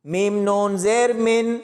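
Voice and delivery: slow, syllable by syllable Quran spelling; slow spelling drill. Shown as a demonstration. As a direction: spell it out letter by letter for learners. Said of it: "پڑھتے ہوئے تین جگہ پر غنہ".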